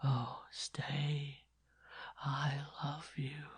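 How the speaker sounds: put-on dying boy begging the nun, whispered; faint far-away whisper. A narrator's reading. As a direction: beg her in a dying whisper. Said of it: "he implored".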